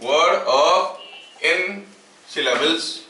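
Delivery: very slow, drawn-out words with long pauses; slow dictation. Acted. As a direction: dictate piece by piece for note-takers. Dictates the definition for writing. (धीमे-धीमे लिखवाते हुए)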